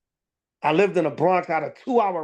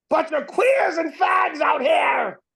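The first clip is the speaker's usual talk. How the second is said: higher, louder, slow put-on yell; mock gunman shout; 7 semitones higher, 4 dB louder, slower than usual. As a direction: bellow it like a crazed shooter. Voicing imagined gunman.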